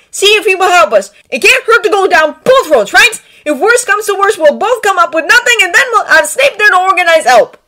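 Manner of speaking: loud, high, forceful planning; exasperated. A narrator's reading. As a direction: lay out the plan at full volume. Character loudly lays out plan.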